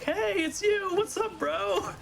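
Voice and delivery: very high excited bro greeting; Johnny G. Retold or imitated. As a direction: squeal it like a hyped buddy. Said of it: "he's like".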